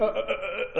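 mock stutter, stuck on one sound. Emperor out-argued by the young girl, imitated stuttering. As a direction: mimic a tongue-tied stammer. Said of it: "like, stuttered".

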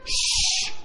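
long sharp shush; hushing. Retold or imitated. Crowd silencing the blind man, hushing. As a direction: shush him like an annoyed crowd.

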